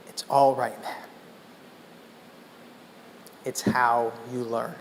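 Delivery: gentle reassurance. Retold or imitated. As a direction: soothe him like a kind elder. Quincy comforts young storyteller.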